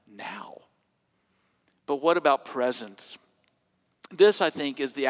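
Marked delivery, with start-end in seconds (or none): 0.1-0.6 whispered. Real, not acted. Preacher hushing the congregation, whispered. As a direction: breathe the word out. Hushed end of a point.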